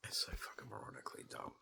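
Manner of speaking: whispered under his breath, voice dropped right off; disgusted whisper. Real, not acted. Venting alone about the war, whispering in disgust. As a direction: hiss it to yourself in disgust. Muttering disgust to himself, aside.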